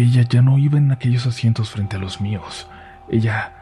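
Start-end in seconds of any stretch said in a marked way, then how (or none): 3.1-3.5 breathless whispered reveal. A narrator's reading. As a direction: whisper it like a chilling secret. Reveal of witch beside him.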